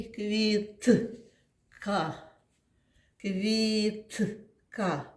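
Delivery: Квітка drawn out slowly, syllable by syllable; slow teaching pronunciation. Shown as a demonstration. Teacher sounds out Квітка to find the third sound. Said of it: "Третій звук який".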